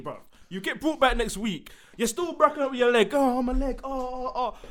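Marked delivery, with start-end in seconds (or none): 3.1-4.6 high-pitched whimpering over a leg; badly faked injury moans; mock pain. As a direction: play up the sore leg mockingly. Mocking wrestler nursing injured leg.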